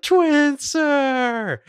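high, loud squeal of a name; retold fan squeal at the TV; excited shout. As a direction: shriek it out in delight. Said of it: "I was just like".

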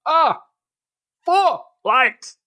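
high, loud shouted quote; Picard impression. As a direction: yell it like a captain. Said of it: "How many lights do you see".

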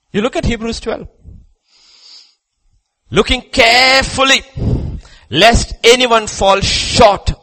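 loud, high-pitched pulpit shout; full-force preaching. Acted. Preacher warning the congregation that evil is stronger, full-force shout. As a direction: preach it at the top of your voice.

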